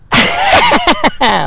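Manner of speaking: very high, loud Joker cackle; evil chuckle. Pretend. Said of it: "give me your best evil villain laugh".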